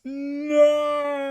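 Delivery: high loud anguished no; scream. Acted. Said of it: "And she screams".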